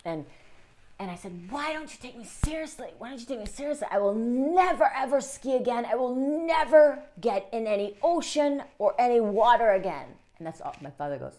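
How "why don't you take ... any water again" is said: higher, building to louder vow; upset and swearing never to ski again; retold upset outburst. Hurt boy protesting to his family, rising upset.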